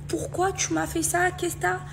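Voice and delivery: high-pitched, upset demand; retold hurt reproach. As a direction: re-enact confronting a friend, pitched well up. Reproche rejoué à la copine. (reproche indigné rejoué)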